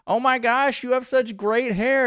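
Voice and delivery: high, loud tipsy gushing; drunk women's voice. Retold or imitated. Mocking drunk women at the bar, high gushing voice. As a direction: mimic the tipsy women fawning. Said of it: "drunkenly being like".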